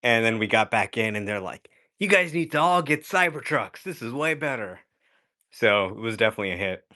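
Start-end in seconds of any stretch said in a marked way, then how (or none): 2.0-4.8 higher-pitched, lightly acted excitement; imitated enthusiasm. Family urging others after a ride, mildly imitated enthusiasm. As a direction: act out a keen relative, starting high.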